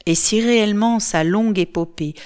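ordinary delivery, nothing marked

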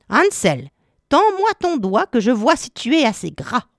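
put-on high-pitched, commanding old witch; demanding witch. A narrator's reading. Witch at the stable checking if Hansel is fat, demanding.

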